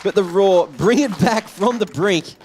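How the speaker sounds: high, frantic commentary yell; screaming play-by-play. Real, not acted. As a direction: lose it over the clutch play. Clutch moment, caster yelling.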